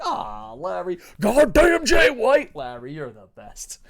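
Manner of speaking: mock rage shouting then tender; retold yelling. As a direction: rant then coo at the bird. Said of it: "I'm, like, yelling about pro wrestling".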